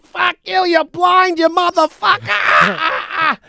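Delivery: high, loud mock shout at the umpire; a hammed-up player blowing up at the ump; angry yell at the ump. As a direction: ballplayer screaming at the umpire over a called strike. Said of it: "Strike two".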